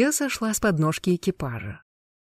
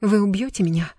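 put-on hesitant, fearful girl's voice; small, halting, scared question; frightened babble; 3 semitones higher than usual. A narrator's reading. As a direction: falter on the first word, then ask timidly. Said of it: "пролепетала она".

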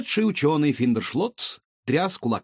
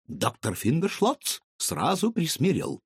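usual speaking voice

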